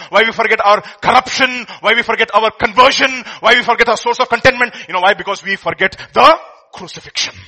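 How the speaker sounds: loud, rising preacher's build; preaching. Acted. Preaching at full force.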